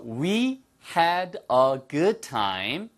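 "We had a good time" word by word; very slow, each word separated and drawn out; slow careful model. Shown as a demonstration. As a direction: spell it out slowly for learners. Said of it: "빨리 하면".

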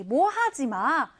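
ordinary talk, nothing marked

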